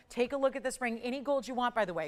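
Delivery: ordinary talk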